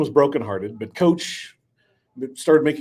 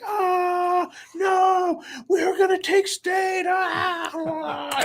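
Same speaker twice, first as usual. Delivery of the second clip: high, shouting cry of despair; panicked scream; much slower than usual. Acted. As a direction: scream as you're dragged under. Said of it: "He's like".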